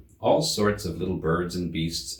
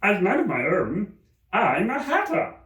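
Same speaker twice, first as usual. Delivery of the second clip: the Hatter; 11 semitones higher, 3 dB louder than usual. A narrator's reading. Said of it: "added as an explanation".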